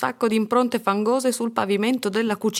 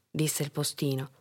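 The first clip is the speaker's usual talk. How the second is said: ordinary delivery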